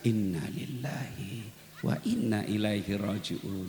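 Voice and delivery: hushed, low and unhurried, hand on chest; quiet drawn-out dhikr; slow and soft. Shown as a demonstration. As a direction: let each word sink in slowly.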